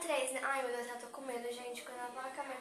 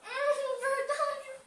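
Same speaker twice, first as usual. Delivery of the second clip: surprised; 11 semitones higher, 6 dB louder, much slower than usual. Real, not acted. Realizes the flavor.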